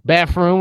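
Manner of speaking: bathroom said as "BAFF-room". Shown as a demonstration. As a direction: say bathroom with an F in place of TH. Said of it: "with an F".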